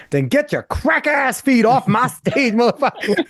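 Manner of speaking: lightly voiced cocky young comic; Dave Chappelle voice. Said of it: "in Dave's way".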